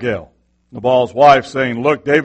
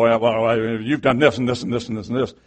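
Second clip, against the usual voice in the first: fast, lower, mock list of faults; rattling off grievances; 4 semitones lower, 4 dB quieter than usual. Wife listing his faults, a rapid mock rattle. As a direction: reel off every old fault in one breath. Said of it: "She gets historical".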